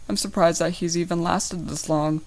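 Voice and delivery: usual speaking voice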